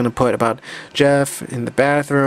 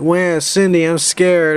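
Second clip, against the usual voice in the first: higher-pitched mock-scared little voice; 5 semitones higher, 3 dB louder, slower than usual. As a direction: act frightened, playfully. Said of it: "this Maria's all scared".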